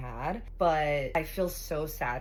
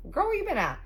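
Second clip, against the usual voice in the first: sassy friend; 6 semitones higher, 5 dB louder than usual. Retold or imitated. Friend calls her out.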